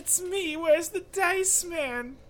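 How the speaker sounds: high, slow nasal whine; nasally wife voice. Pretend. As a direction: play the whiny wife calling out. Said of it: "like, the nasally voice".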